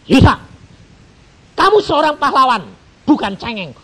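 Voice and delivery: loud high scolding; stern rebuke. Acted. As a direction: rebuke him like a stern master. God rebukes him loudly. (tegur keras dan tinggi)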